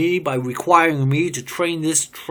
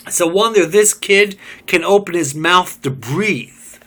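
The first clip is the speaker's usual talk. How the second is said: loud, high, bitter tirade; bitter rant; 6 semitones higher, 6 dB louder than usual. Acted. Job ranting to the group about Smith, loud and bitter. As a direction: spit out the resentment.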